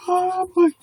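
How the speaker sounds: retold high, slow disbelief; shocked. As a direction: let the shock stretch out high. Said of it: "I was like".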